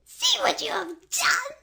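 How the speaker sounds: very high screaming witch. A narrator's reading. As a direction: scream it as you shrink. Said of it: "she screamed".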